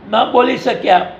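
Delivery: ordinary delivery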